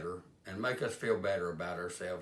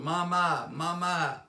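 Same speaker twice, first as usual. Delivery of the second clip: higher, louder mock call of a lost boy; child hollering; 8 semitones higher, 6 dB louder, much slower than usual. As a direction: holler for mama like a scared kid. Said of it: "he started hollering".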